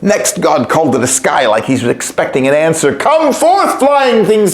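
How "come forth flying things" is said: high ringing call to the sky; bright high summons. A narrator's reading. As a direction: summon the birds from afar. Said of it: "he called".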